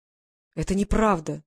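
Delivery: slow, emphatic protest; exclaiming. A narrator's reading. Woman denies he is seen as a traitor, exclaiming. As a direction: exclaim it as a firm denial. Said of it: "воскликнула она".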